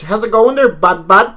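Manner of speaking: high, loud mock mainland buddy voice; mainland Canadian greeting. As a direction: ham up a chirpy Ontario greeting. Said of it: "in, like, mainland".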